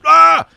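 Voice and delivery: very high, very loud mock scream; imitated frightened yell. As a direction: yell like bikers taking fright. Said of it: "they just go".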